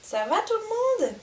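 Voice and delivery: high, bright greeting to the herd; cheerful call. Real, not acted. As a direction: sing out hello to the horses. Calling to the herd in shelter.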